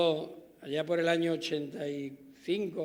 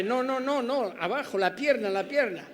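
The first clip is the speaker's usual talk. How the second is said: urgent no no no, higher and louder; hurried correction; 6 semitones higher, 4 dB louder than usual. Retold or imitated. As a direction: stop him quickly before he drops them. Judge stops witness dropping trousers.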